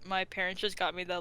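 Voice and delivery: normal talking voice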